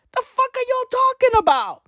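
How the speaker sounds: what the hell are you on about; ranting at fans who gush; exasperated outburst. Real, not acted.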